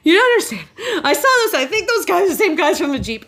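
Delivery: high, laughing, breathless retelling; frantic laughing. Retold or imitated. Telling her friend on the phone, high and laughing. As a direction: gush it out through nervous laughter. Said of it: "And I'm going".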